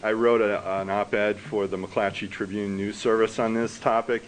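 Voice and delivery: normal talking voice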